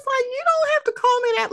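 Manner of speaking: very high mimicked objection; high-pitched protest. Friend objects to being called by her title, high mock protest.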